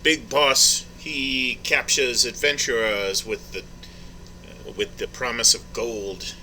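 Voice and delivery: slow, dramatic storyteller tone; slow ominous reveal. Acted. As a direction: draw it out ominously.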